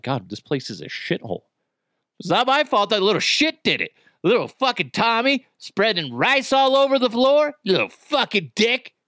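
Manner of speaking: hammed-up Italian gangster cursing at a toddler; a put-on New York Italian wiseguy, fed up. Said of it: "that is my Italian gangster voice".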